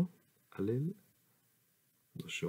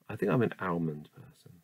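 usual speaking voice

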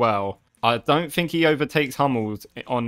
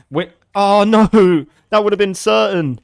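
high, loud dismay; anguished cry; 6 semitones higher, 8 dB louder than usual. Real, not acted. Gamer crying out at a missed chance, loud. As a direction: groan at the missed sure goal.

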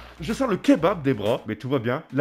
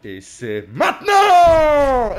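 dramatic drawn-out reveal; 8 semitones higher, 7 dB louder, much slower than usual. Real, not acted.

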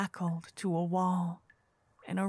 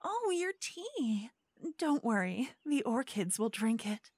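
sweet scheming hostess; 7 semitones higher than usual. Acted. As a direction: coax the guest to stay, sugar over suspicion. Host character speaks to guest.

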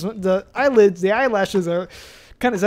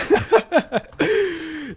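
high-pitched burst of laughter; 6 semitones higher than usual. Real, not acted. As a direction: let out a laugh. Laughs at own spooky game.

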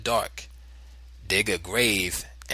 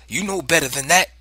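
loud, high angry rebuke; storming anger; 7 semitones higher, 8 dB louder than usual. A narrator's reading. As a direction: storm at your child. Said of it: "my father stormed".

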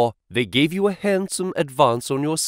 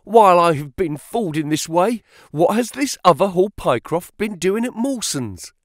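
higher-pitched, agitated questioning; agitated cry; 6 semitones higher, 3 dB louder than usual. A narrator's reading. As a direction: press the question with pitch raised. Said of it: "he cried".

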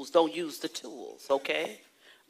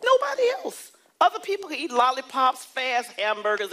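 high, louder preaching cry; emphatic cry; 6 semitones higher, 5 dB louder than usual. Real, not acted. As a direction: cry it out to the church.

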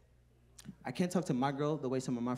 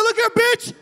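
high, loud hammed-up outburst; mock tough yell; 10 dB louder than usual. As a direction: bark it like a wannabe boss.

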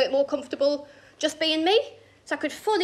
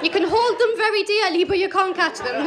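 higher, louder playful comeback; giddy retort; 5 semitones higher, 5 dB louder than usual. Retold or imitated. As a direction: call it out with drunken cheek. Drunk giddy retort, played up.

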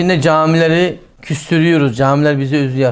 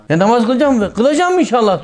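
higher, louder hypocrite voice; mock eager promise; 7 semitones higher, 4 dB louder than usual. Preacher mimicking a hypocrite promising to pray, higher and louder. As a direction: play the man who swears he'll pray. Hypocrite answering about prayer.

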